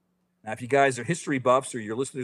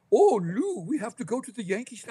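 put-on much higher old cowboy voice; Walter Brennan impression; 10 semitones higher, 4 dB louder than usual. Mimicking the movie for podcast hosts, high old-timer voice. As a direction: squeak it out like a creaky old sidekick. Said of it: "a cowboy with a Boston accent".

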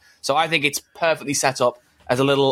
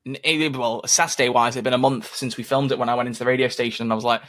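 ordinary talk

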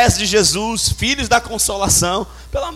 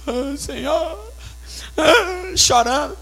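mock tearful sighs, drawn out slowly; imitating crying; 6 semitones higher, much slower than usual. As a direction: act out a crying sister, half-hearted. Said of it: "tá aqui chorando no culto". (imitando choro, em tom de brincadeira)

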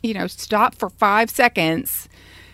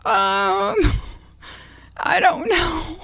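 sheepish; 7 semitones higher, 3 dB louder, much slower than usual. Retold or imitated. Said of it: "And I was like".

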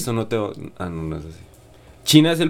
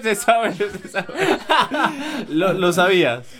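laughing, much higher; 8 semitones higher, slower than usual. Real, not acted. Host laughing at his friend, high and giggly. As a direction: giggle through "se sabe".

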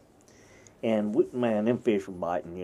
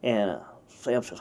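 ordinary talk

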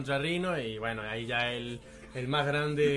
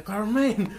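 dramatic movie quote; 6 semitones higher, 5 dB louder than usual. Retold or imitated. Quoting film character, dramatic.

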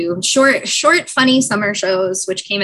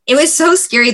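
excited; 8 semitones higher, 5 dB louder than usual. Retold or imitated. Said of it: "daughter said it was scary".

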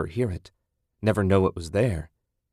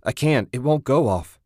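reply; 5 semitones higher, 4 dB louder than usual. A narrator's reading. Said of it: "Ted replied".

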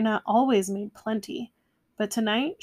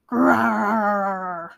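growl; 5 dB louder than usual. A narrator's reading. Bear answers at table.

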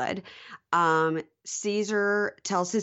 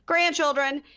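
"Grandchildren!" much higher and louder; outraged exclaim; 9 semitones higher, 4 dB louder than usual. Real, not acted. Host stressing she only showed grandchildren pics, exclaiming "Grandchildren!". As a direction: blurt "Grandchildren!" in disbelief. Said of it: "her grandchildren".

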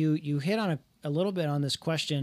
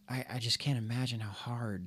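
hushed; 3 semitones lower, 6 dB quieter than usual. Real, not acted. Awed by how hard nursing is.

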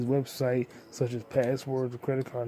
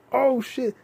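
much higher, louder exclamation; sudden surprise; 12 semitones higher, 8 dB louder than usual. Real, not acted. Vlogger suddenly recognising an actor, loud surprised. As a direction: blurt it out.

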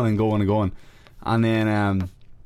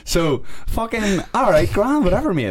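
mock dismissive; 10 semitones higher than usual. Dismissive brush-off while laughing.

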